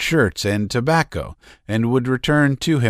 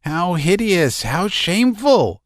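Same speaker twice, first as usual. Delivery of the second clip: hysterical; 10 semitones higher than usual. A narrator's reading. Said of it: "he was in hysterics".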